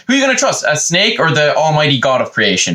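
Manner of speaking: high, mock-incredulous question; exasperated scolding. God arguing with Eve, high exasperation. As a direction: start high in exasperated disbelief.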